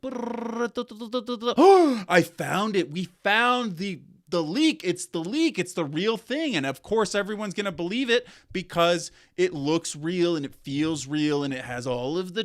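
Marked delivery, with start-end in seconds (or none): 0.0-6.5 thrilled and loud, very slow start; excited. Retold or imitated. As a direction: announce the find with breathless glee. Opportunistic hackers find the leak.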